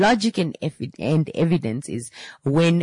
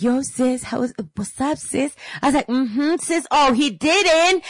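hype man; 10 semitones higher than usual. Retold or imitated. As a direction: egg her on like a best friend hearing tea. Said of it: "I was doing all of the, the theatrics".

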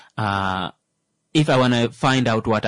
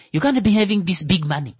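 high, animated Namibian English; Namibian English accent; 8 semitones higher than usual. Retold or imitated. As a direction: play a Namibian taxi driver in Namibian English. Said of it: "I love Namibian English".

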